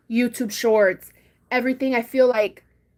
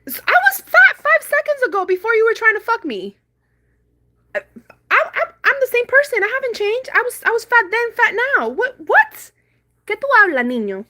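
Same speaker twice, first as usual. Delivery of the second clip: very high, loud, indignant rant; 12 semitones higher, 6 dB louder than usual. Retold or imitated. Woman going off on the guy at the gas station, crazy outburst. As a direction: go off on him, pitched way up.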